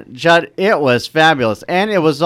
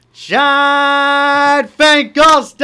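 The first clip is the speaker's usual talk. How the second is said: long drawn-out high shout; announcer shout; 11 semitones higher, 5 dB louder, much slower than usual. Acted. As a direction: call out the host's name like a game-show announcer. Announcing the host's name on air.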